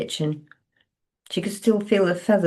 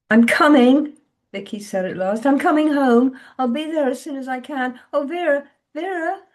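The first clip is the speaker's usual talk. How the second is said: put-on a higher, louder distraught young woman; Vicky, shaken; 9 semitones higher, 5 dB louder than usual. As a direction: push through the shock, pitch up. Vicky answers on phone.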